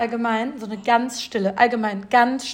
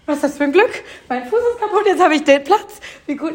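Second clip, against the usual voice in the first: higher, louder, laughing with joy; excited delight; 5 semitones higher, 4 dB louder than usual. Retold or imitated. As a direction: gush about your luck, laughing. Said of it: "so begeistert". (begeistert und lachend, höher und lauter)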